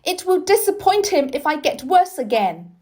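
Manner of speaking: put-on boy insisting loudly to the doctor; still shouting. A narrator's reading. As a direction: keep the angry shout going. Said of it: "he shouted".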